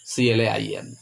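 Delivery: C L A I M spelled letter by letter, each letter separate; spelling out. Shown as a demonstration. Teacher spelling C L A I M aloud for students, letter by letter. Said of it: "spells claim letter by letter".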